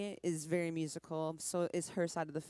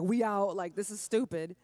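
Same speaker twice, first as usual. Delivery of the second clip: louder, fed-up mock complaint; playful fed-up outburst voiced for her hair; exasperated; 3 semitones higher, 6 dB louder than usual. Voicing her hair giving up on the cold, a mock fed-up outburst. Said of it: "I was like".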